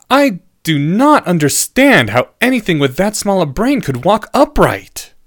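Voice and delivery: high-pitched, emphatic bafflement; appalled student. A narrator's reading.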